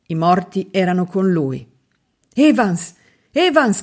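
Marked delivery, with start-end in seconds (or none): 2.3-3.8 high calling cry, rising on the second; shouting a name. A narrator's reading. Septimus calling out to dead Evans, crying out. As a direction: call out to the dead friend. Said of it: "gridò Septimus".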